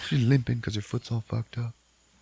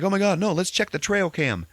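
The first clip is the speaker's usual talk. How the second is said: higher, louder frantic voice; mock panic; 10 semitones higher, 3 dB louder than usual. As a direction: rush it in alarmed put-on panic. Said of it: "So, like".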